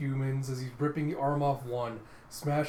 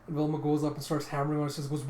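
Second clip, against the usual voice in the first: ordinary delivery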